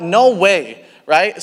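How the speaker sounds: ordinary talk, nothing marked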